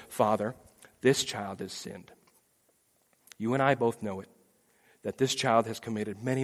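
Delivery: soft, low, measured case; solemn advocate. Pretend. Jesus states the case to the Father, quiet and solemn. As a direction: lay it out gravely and quietly.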